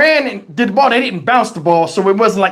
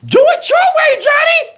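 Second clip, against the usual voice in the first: grandma voice. Retold or imitated. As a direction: squeal it like grandma.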